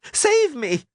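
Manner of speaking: very high, panicked plea; Dandelion's shout. A narrator's reading. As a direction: cry out to be rescued. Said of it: "Dandelion shouted".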